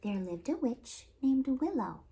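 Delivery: put-on lively, expressive read-aloud for little kids; storytime narration. A narrator's reading. Children's librarian reading a fairy tale to kids, lively storytelling.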